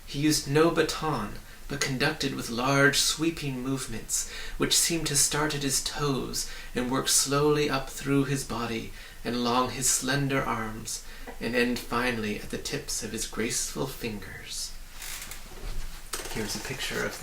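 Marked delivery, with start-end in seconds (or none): none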